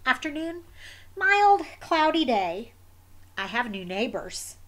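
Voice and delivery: usual speaking voice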